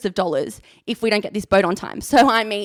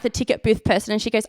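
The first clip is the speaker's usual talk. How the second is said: ordinary delivery, nothing marked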